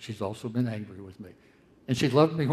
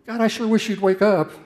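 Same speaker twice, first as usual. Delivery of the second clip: much higher and louder, exasperated plea; frustrated cry to a silent God; psalmist's complaint; 11 semitones higher, 11 dB louder than usual. Retold or imitated. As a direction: call out to God to wake up. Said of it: "the psalmists who say".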